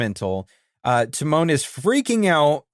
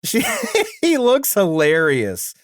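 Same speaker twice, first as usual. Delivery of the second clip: giggling through the words, slowing down; laughing; 7 semitones higher than usual. Real, not acted. Co-host picturing Pumbaa dressed as Patty, laughing. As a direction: crack up, then push the words out.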